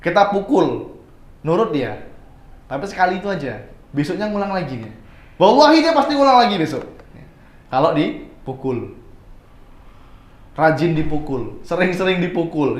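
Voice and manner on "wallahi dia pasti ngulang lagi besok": loud, high insistence; emphatic oath. Real, not acted. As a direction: swear by it with force. (sumpah dengan suara keras dan tinggi)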